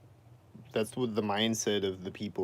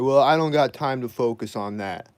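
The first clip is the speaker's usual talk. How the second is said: grandma brushing off; 3 semitones higher, 9 dB louder than usual. Retold or imitated. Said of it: "She's like".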